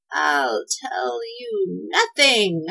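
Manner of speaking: very slow, whispery witch rasp. A narrator's reading. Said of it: "rasped the witch".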